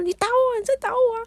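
very high indignant mock protest; retold wounded outrage; angry. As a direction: act outraged, voice shooting way up. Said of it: "然后我就很生气的说".

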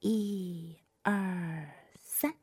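very soft count-in; quiet count-in. Acted. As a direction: count in softly. Counting in before playing.

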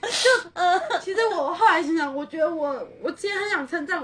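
real laughter breaking into tears; laughing and crying. Real, not acted. Laughing and crying with the cohost over her own story.

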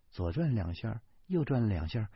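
usual speaking voice

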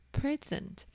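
"present" stressed up front, PRE-sent; stress on first syllable. Shown as a demonstration. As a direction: say "present" as the noun, weight on PRE. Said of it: "把重音放在前面第一个音节的时候". ("present"重音放在前面第一个音节)

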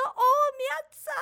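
very high, loud shout of her name; cheering call. Retold or imitated. Bystanders cheering her goal, high loud call.

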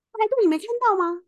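high, loud, fast retold yell; furious yell. Angry man behind her shouting about the queue, fast loud retold yell. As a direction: snap it out like he is losing it. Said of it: "对我大咆哮".

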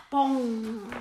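booming crash sound; loud bang effect. Acted. Penguin dad smashing in, loud bang. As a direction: shout the bang.

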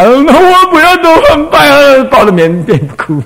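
mock sulking student. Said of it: "你不要说".

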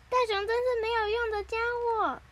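high-pitched cartoon put-down; mock jeer. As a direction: voice a scornful line in a high pitch. Retold jeer at a story character.

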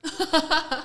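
loud, high laugh; burst of laughter. Real, not acted. Guest reacts to host calling himself smaller, loud laugh. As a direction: crack up suddenly. Laughing at host's size remark.

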